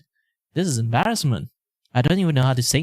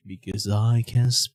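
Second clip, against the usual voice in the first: ordinary delivery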